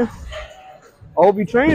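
slow, drawn-out taunt; slow teasing drawl. Real, not acted. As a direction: stretch it out slowly, teasing.

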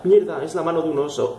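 normal talking voice

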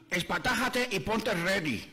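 very slow gringo Spanish with English mixed in; American speaking Spanish. Acted. American taunts the Puerto Rican, in slow gringo Spanish. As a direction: stretch it out like a smug American. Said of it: "Y le dijo al boricua".

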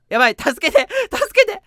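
high, loud panicked pleas; mock cry for help. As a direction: squeal for help in a hammed-up panic. Girl character panics. (芝居がかった悲鳴の助けて)

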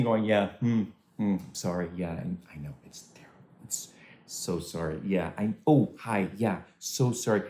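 soft, awkward, fading condolence mumbles. Retold or imitated. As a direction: grimace and trail off, embarrassed. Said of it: "you're just sort of grimacing".

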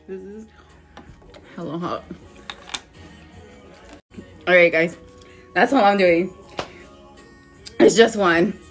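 loud, strained, mouth on fire; mouth burning from spicy food. Real, not acted. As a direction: push words out through the burn. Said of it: "This shit is so hot".

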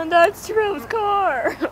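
high, slow mock creepy drawl; mock stalker voice. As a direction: copy a friend's creepy line, hammed-up. Copies friend's creepy line.

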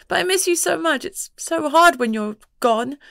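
put-on a young girl aching for her mum; crying little girl. A young girl clings to her mum, tearful and pleading.

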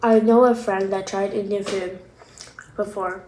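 speaking between bites; talking while chewing. Real, not acted. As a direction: mouth partly full of food. Seen 0: Bites food at -1.0, jaw chewing while speaking, licks fingers.

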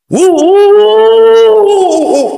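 loud, high startled huffing; real fright, breath jolted out; startled gasps. Real, not acted. Vlogger jolted by a sudden sight, startled gasps.

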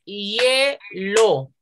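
hielo slowly split hie-lo; two syllables. Shown as a demonstration. Teacher corrects confused class, hielo broken slowly into hie-lo. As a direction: stretch hielo as two beats, I and E together. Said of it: "Solo tiene dos sílabas".

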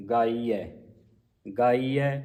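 ਗਾਈਐ ending in an open ai; correct pronunciation. Shown as a demonstration. Teacher repeats ਗਾਈਐ for students. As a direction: end ਗਾਈਐ on ai. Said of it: "ਦਲਾਵਾਂ ਲੱਗੀਆਂ ਐੜੇ ਨੂੰ".

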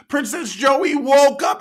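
ordinary talk, nothing marked

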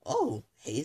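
ordinary talk, nothing marked

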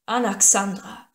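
loud, slow ringing call of the name; trumpeting call. A narrator's reading. King calls her name before all his men, ringing loud. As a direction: call her name so the whole army hears. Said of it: "seine Stimme erschallte wie eine Trompete".

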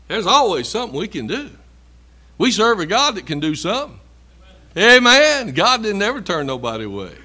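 loud, ringing preacher's cry; fiery sermon peak; preaching at full force. Acted. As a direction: lift the congregation, building to a loud Amen.

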